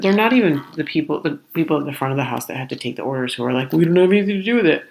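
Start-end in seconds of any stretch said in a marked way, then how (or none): 3.7-4.8 quick, higher brush-off; imitating fed-up staff, rushed. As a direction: rattle it off to get rid of the customer. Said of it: "they're fed up with it".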